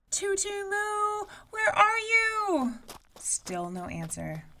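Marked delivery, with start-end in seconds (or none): 0.1-2.8 high, slow, distant calling; mother calling. A narrator's reading. Mother running the trail, calling her daughter. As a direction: call her name far through the woods.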